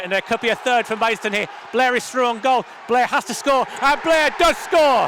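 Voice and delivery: building to a roaring goal call; goal call shout. Real, not acted. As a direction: yell it as the goal goes in.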